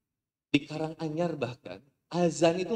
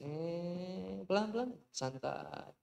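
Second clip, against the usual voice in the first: soft soothing; 4 semitones higher, 7 dB quieter, much slower than usual. Retold or imitated. Gentle instructor.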